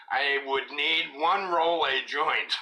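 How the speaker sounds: slow, deliberate reading of a chat line; word-for-word read. Shown as a demonstration. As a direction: read it out slowly, word by word, like quoting exactly. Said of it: "I'm going to read this word for word".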